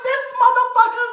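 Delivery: high, loud mock-furious outburst; retold rage. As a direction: curse him in exaggerated exasperation. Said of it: "I was so mad".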